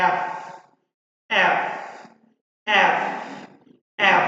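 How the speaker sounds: each এফ punched out with a long airy f; airflow on F. Shown as a demonstration. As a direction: blow air onto your hand with the f of এফ. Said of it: "ঠোঁটের সামনে হাত দিলে যাতে air ফ্লোটা বুঝতে পারি". (এফ-এর ফ-তে জোরে বাতাস ছেড়ে উচ্চারণ)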